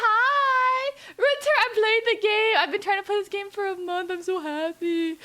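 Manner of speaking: very high, loud, delighted greeting; excited squeal. Real, not acted. Streamer greets friend Winter in chat, excited squealing.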